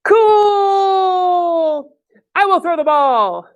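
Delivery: high, drawn-out cheer; keen storybook enthusiasm; excited character voice. Acted. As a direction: stretch the first word out high, then announce. Excited character in read-aloud.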